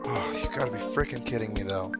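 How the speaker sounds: ordinary delivery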